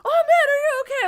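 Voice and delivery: imitating a worried friend, high and loud; concerned girlfriend. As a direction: rush in to check on him. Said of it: "was like".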